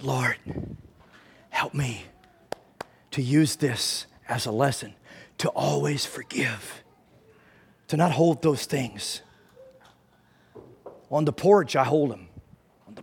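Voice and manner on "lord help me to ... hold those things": soft, low, halting plea to God; hushed prayer. Retold or imitated. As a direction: pray it quietly, with long pauses. Recalls his humbled prayer.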